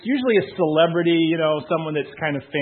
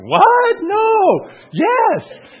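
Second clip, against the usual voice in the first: high, loud squeal of disbelief; shocked disbelief; 9 dB louder, much slower than usual. Retold or imitated. Guest shocked to learn ancestry, squealing disbelief. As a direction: shriek it like the news is impossible.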